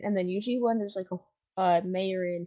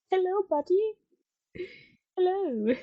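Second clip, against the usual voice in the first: high sweet pet voice; cooing at a pet; 11 semitones higher than usual. Real, not acted. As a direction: coo at the chicken. Greeting a chicken in game.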